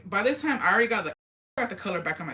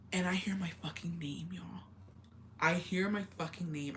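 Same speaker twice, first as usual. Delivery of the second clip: soft, half-whispered creepy storytelling; near-whispered, spine-tingling campfire delivery; hushed suspense; 8 dB quieter than usual. Real, not acted. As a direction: drop your voice for the scary reveal. Eerie turning point of scary story.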